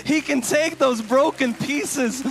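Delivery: loud, high, pleading sermon voice; full-force preaching. Acted. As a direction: drive it home to the hurting congregation.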